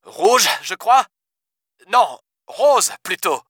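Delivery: slow, unsure; hesitant correction. A narrator's reading. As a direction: weigh it before correcting. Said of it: "nuança-t-il". (Seymour hésite puis se reprend)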